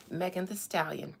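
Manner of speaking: ordinary talk, nothing marked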